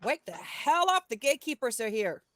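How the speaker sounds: loud, high wake-up yell; urgent in-character shout; acted yell. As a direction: yell to rouse a sleeper. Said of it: "and yell".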